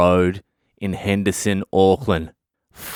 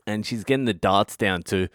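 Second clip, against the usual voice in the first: normal talking voice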